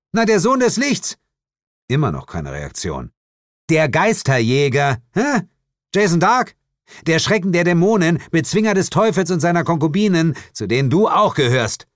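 höher und lauter, sich in Fahrt redend; prahlerisch. Acted. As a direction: prahl mit deinen Titeln. Held prahlt mit seinen Titeln. (prahlend, höher und lauter, schnell aufgezählt)

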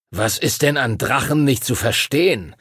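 usual speaking voice

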